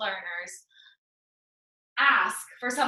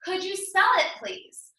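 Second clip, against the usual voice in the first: teacher; 7 semitones higher than usual. Acted. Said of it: "says to her student".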